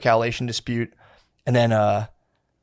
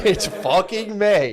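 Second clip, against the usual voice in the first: higher, slow, drawn-out mock insistence; mock indignant; 8 semitones higher, much slower than usual. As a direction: ham up the stubborn sect's claim. Mocking the sect's quarrel.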